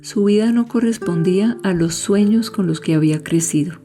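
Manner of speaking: usual speaking voice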